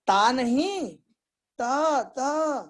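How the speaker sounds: imitating a frustrated lisping teacher; hammed-up insistence on the mispronounced sound; mock emotional insistence. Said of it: "बड़े भाव में आकर के".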